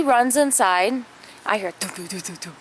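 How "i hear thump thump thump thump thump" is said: normal talking voice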